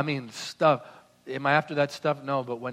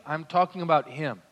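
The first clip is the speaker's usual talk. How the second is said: ordinary talk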